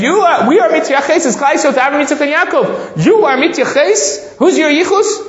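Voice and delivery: excited paraphrase. Acted.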